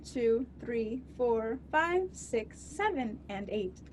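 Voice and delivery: cheery in-flight safety announcement; flight attendant. Acted.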